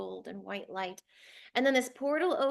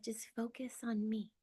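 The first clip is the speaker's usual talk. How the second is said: hushed, tender near-whisper; gently soothe, very softly; soft loving reassurance; 6 dB quieter than usual. Retold or imitated. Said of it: "And it was so loving".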